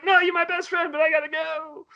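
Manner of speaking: high mock-tender goodbye; squeaky creature saying goodbye; creature farewell. Creature says goodbye to kid.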